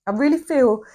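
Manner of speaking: normal talking voice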